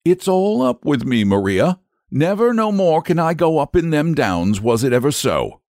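agitated exclamation. A narrator's reading. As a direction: exclaim it high, somewhat worked up. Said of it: "exclaimed with much agitation".

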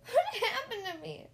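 loud, high-pitched wail; hammed-up pained bewilderment; whimpering. A narrator's reading. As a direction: whimper the question in a squeal. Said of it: "I whimpered".

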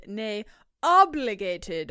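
nay, obligated stretched slowly and loud; emphatic, drawn out. Acted. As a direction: lean hard on obligated. Emphatic reading of letter.

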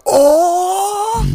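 high loud excited gasp. Retold or imitated. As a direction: burst out with a high oh. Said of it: "but just be like".

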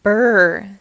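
loud, drawn-out "brr" against the cold; a big brr as if freezing; shivery brr. Acted. Millie talking about the chilly penguin home, shivery brr.

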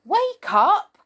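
high, loud shout of wake up; crying out. Acted. Peter shouting at the sleeping pixie to wake. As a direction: yell it to rouse a sleeping friend. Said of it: "cried Peter".

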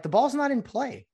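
high mock matter-of-fact umpire; umpire's reply. Umpire tells partner the ball is dead, in a high mock voice. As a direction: voice the other umpire explaining. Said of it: "they're like".

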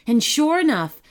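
plain reading voice

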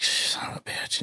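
slow, hushed swearing under the breath; whispered curse. Retold or imitated. Owner finding the dog dead, whispered curse. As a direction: whisper it slowly in dismay.

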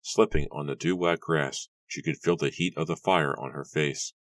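usual speaking voice

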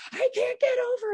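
very high, frantic protest; desperate retold cry. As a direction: throw it out in pure panic. Retold panic on the highway.

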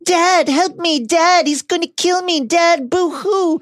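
high, slow mock whimpering; shouting in sleep. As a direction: imitate a crybaby calling for dad. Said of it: "he's been screaming in his sleep".

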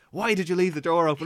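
lightly acted scolding question; mock scolding. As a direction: imitate an exasperated nurse. Nurse scolds him for escape.

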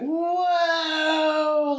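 high, startled exclamation; mock startle at the bed tipping; surprised whoa. Wife reacting to husband's story, high startled whoa.

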